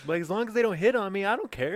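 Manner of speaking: high, loud mock shrug; brash hammed-up indifference. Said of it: "continuing the bigot joke bit".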